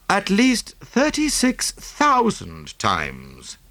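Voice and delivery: interrupting. A narrator's reading. Hastings interrupting Poirot, slow and dry. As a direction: cut in with weary irony. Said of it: "I interrupted".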